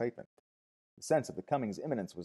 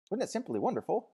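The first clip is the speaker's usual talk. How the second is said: high, blissful exclamation; rapturous woman; 11 semitones higher than usual. A narrator's reading. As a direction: breathe rapture into it, pitched high. Fifi's rapture after the service.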